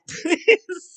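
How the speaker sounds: high, loud burst of laughter. Real, not acted. Laughing after doing Walter's accent, real amused laugh. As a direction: crack up at your own bad accent.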